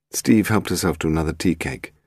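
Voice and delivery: ordinary delivery, nothing marked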